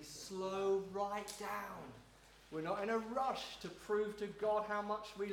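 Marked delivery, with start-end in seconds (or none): 0.0-1.9 very slow, drawn-out words; deliberately slowed down. Real, not acted. Preacher urging unhurried Bible reading, deliberately slow. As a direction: stretch each word to show slowing down. Enacts slowing down while teaching.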